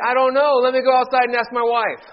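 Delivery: loud, high punchline; punchline delivery. Acted. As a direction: land the punchline big.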